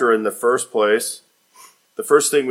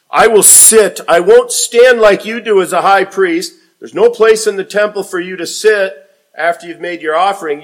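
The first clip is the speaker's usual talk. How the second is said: higher, louder, firm statement of I will sit; emphatic declaration; 6 semitones higher, 5 dB louder than usual. Acted. Jesus to the high priest, loud high insistence. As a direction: set yourself apart from the standing priest.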